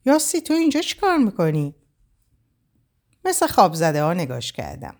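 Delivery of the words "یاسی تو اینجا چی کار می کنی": high, startled asking; a touch of shock as she asks; mother startled to find her daughter there; surprised question. A narrator's reading. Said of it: "با حیرت پرسید".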